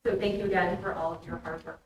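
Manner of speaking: usual speaking voice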